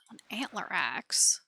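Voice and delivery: slow, hushed whisper. Real, not acted. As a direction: breathe the name out softly. Reads item name in a whisper.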